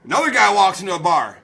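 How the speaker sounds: ordinary talk, nothing marked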